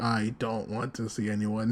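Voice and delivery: low, very soft murmur; withdrawn. Retold or imitated. Aqua refusing to see anyone, low and withdrawn. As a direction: drop right down, hushed and low.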